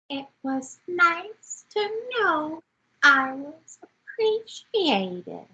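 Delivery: a slow, light mock of a coy schoolgirl; coy little schoolgirl. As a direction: lightly play it bashful and fishing for praise. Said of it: "Like a coy little schoolgirl".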